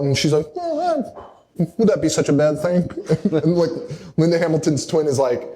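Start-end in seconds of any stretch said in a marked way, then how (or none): 0.6-2.9 put-on sly old lady. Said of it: "talking like a crazy person".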